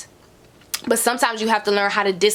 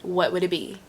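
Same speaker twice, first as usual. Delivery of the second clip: normal talking voice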